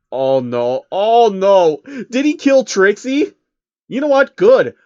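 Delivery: the reader cheering, loud and high, that Trixie is dead; excited outburst. Real, not acted. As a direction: burst out of the story gloating about the death. Reader reacts to Trixie's death.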